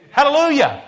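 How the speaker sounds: high, loud hallelujah cry; shouted hallelujah. Real, not acted. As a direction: call out hallelujah with fire. Preacher shouts praise.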